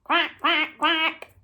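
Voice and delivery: short, evenly spaced quacks; duck quack. Acted. Presenter giving children a clue, quacking like a duck. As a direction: imitate a duck. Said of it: "And it goes".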